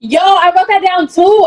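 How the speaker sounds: high, loud and slow, thrilled; excited agreement. Real, not acted. Telling her co-host she noted it too, loud excited.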